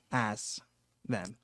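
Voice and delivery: ordinary delivery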